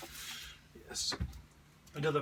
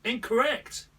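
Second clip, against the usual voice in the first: high, loud "Incorrect!" verdict; game show host; 10 semitones higher, 8 dB louder, slower than usual. Pretend. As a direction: deliver it like a quiz host.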